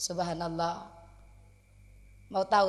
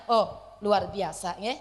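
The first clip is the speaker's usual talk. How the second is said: ordinary delivery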